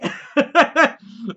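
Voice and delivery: loud, high unhinged cackle; manic laugh. Acted. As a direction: laugh like a crazed stalker. Laugh within the impression.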